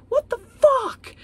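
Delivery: high, loud, slow cry of outrage; real angry cry. Real, not acted. Reader venting at her favourite author, crying out in real anger. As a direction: wail it out, fed up and hurt. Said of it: "I'm really angry".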